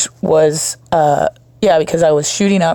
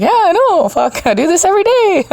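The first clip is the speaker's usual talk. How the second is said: cocky brag; 13 semitones higher than usual. Retold or imitated. Bragging to the nurse about her skill, smug and high. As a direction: toss it off like an old pro. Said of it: "I'd be like".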